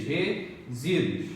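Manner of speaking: "resíduos" slowed right down, syllables apart. Shown as a demonstration. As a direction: separate the syllables of "resíduos" with a pause. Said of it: "Faça a separação silábica". ("resíduos" devagar, sílaba por sílaba)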